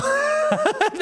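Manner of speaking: sharp high laugh of disbelief; high disbelieving laugh. Real, not acted. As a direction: burst out laughing in shock.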